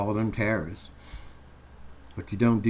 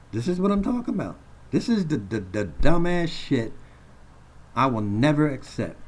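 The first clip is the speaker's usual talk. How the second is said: high outraged outburst; 5 semitones higher than usual. Real, not acted. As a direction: pitch jumps way up, rushed then dragging on dumbass shit. Furious rant at injustice.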